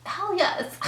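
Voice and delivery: eager yes. Retold or imitated. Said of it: "And I was like".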